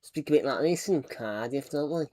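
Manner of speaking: a light Welsh lilt, a quick demo; Welsh accent. Shown as a demonstration. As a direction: show off a mild Welsh accent for chat. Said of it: "I can even do a Welsh accent".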